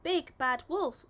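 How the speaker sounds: calling out. A narrator's reading. Assistant calls the wolf from the waiting room: called out. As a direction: call the patient in from the door. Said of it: "called the dental assistant".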